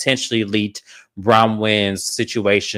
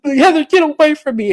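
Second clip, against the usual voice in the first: very high mock shriek; outraged; 4 dB louder than usual. Lisa sends Heather away, outraged. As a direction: imitate a hysterical brush-off.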